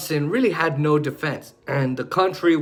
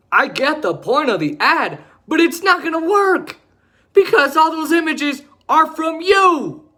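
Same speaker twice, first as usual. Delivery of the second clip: higher and louder, berating; angry rant; 8 semitones higher, 3 dB louder than usual. Real, not acted. Scolding Trump over his ad, heated rant. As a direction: tear into him, calling him names.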